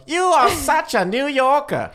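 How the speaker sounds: put-on slow, high sing-song Swedish accent. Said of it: "You know how Swedish guys talk".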